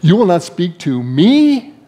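slow question with "me" swooping and stressed. Acted. Incensed Pilate challenging silent Jesus, stress on "me". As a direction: land hard on "me", drawn out in disbelief. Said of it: "get the emphasis on the right word".

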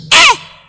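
sharp high cry of grief; shouted cry of anguish; high anguished wail. Acted. King's wail.

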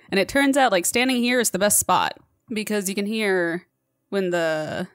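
ordinary talk

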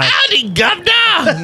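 high, drawn-out mock-English holler; hammed-up cockney-style English greeting; bad English accent. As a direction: call out in a terrible fake English accent. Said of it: "that was a horrible English accent".

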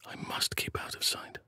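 hushed whisper, keeping out of sight; stealthy whisper. Acted. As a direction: breathe it so no one hears. Sneaking, keeping hidden.

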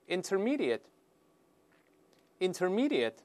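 "intermediate" slowly, ending in a short "-ət"; correct adjective pronunciation. Shown as a demonstration. Teacher repeats the word for students, correct short-ending pronunciation. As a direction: drill "intermediate" with a reduced last syllable. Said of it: "再来一遍".

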